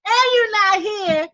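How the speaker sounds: high, loud, laughing tell-off; loud, high, playful rant at the spirit; mock scolding. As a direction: scold him in disbelief, half laughing.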